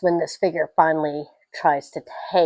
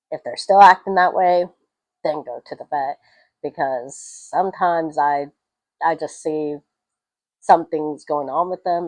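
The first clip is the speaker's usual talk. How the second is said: normal talking voice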